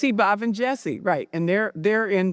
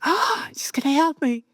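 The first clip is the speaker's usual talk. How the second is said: acted-out elation; 9 semitones higher than usual. Alone, seeing Stacy's email arrive, giddily hopeful. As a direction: re-enact breathless hope in a high voice.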